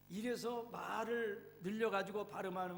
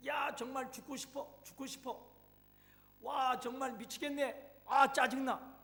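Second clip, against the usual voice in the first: higher, loud, frantic ranting; fiery angry outburst; 5 semitones higher, 4 dB louder than usual. Acted. Woman ranting after crashing her car, furious. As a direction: explode in frustration after the crash. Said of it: "그녀의 입에서 또다시 불같은 화가 터져나왔습니다".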